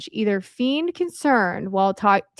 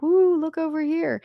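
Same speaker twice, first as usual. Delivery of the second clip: higher-pitched, lightly mocking "ooh"; mock excited crowd; 6 semitones higher than usual. Speaker mimics everyone distracted by foreign fraud, mock excited. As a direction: imitate a crowd eagerly pointing something out. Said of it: "everyone was like".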